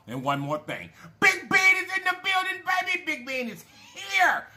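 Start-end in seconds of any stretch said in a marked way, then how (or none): 1.2-3.0 high, loud announcer-style call-out; shouted guest intro; hype shout. Real, not acted. Hyping up a special guest. 3.1-4.5 higher, slower, drawn-out hype call; sing-song intro of the guest; excited announcement. Real, not acted. Hyping up a special guest.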